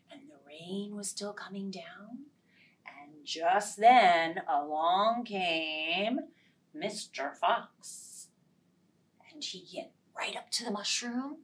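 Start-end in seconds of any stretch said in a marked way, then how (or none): 2.9-8.3 slow, lower Mister Fox; ominous. A narrator's reading. Storyteller announcing the fox, slow and ominous. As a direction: draw out the fox's arrival.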